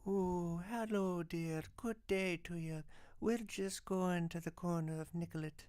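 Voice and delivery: put-on high, soft prim old Scottish nanny; Mrs. Doubtfire voice. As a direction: coo it like a genteel old nanny. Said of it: "dressed up like fucking Miss Doubtfire, and he sounds exactly".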